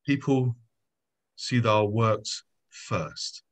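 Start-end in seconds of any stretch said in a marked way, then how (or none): none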